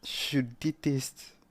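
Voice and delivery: very slow, stretched words; drawn-out loathing. Acted. Player repeating their hatred of someone, slowly drawn out. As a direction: drag each syllable out.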